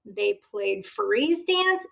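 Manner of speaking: usual speaking voice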